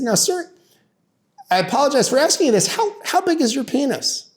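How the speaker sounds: high-pitched, delicately polite courtroom voice; mock-apologetic questioning. Lawyer questioning the husband on the stand, mock-apologetic. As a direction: tiptoe up to an awkward question.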